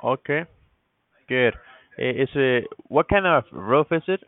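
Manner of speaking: usual speaking voice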